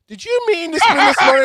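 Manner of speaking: bank voice. Retold or imitated. Bank texting about the big charge, prim high alert voice. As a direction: mimic a fussy bank alert. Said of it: "my bank going".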